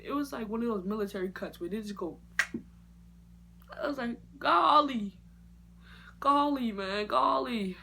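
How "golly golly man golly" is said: high, loud dismayed golly, slowing down. Retold or imitated. Seeing her buzzed hair, a loud dismayed groan. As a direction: groan it in disbelief.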